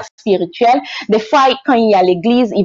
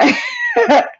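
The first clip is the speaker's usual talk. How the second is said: very high-pitched real laugh; sheepish laugh. Real, not acted. Admitting they changed their accent, sheepish laugh. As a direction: laugh while owning up to it.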